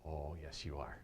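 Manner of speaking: lightly voiced old Ghanaian man, amused; old man, knowing. Old man insists he's rich.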